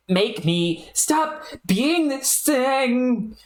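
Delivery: rising frantic pleading, slow at the end; a hint of a desperate youth; panicked character. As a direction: beg the clown to undo it.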